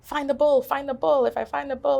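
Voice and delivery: high, loud, driving repetition; urgent self-urging. Retold or imitated. Turning and running to orient herself, urgent self-urging.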